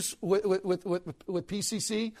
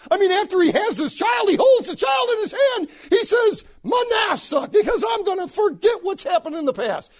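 high, loud, shouted preaching; 10 semitones higher, 5 dB louder than usual. Acted. Preacher to his congregation, shouting the vow. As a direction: preach it at full force.

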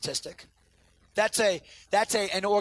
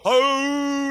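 short mock howl, a bit higher and louder; imitating a howl; 6 semitones higher, 6 dB louder than usual. Son starting the duet again, short mock howl. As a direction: toss off a quick husky howl. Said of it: "He'll do the exact same tone".

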